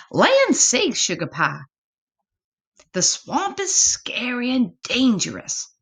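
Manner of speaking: put-on high, fretful Southern mama warning her child; fussing mama. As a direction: exclaim it like a worried mother. Mama warns her son.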